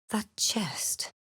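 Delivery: whisper, sensing hidden magic; awed whisper. Acted. Cleric noticing a magic chest, hushed whisper.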